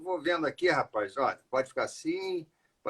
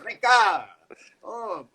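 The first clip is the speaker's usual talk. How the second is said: louder, higher call; 7 semitones higher, 3 dB louder, much slower than usual. Real, not acted. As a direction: call someone from another room. Calling out to his wife.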